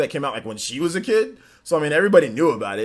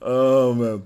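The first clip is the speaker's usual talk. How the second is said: usual speaking voice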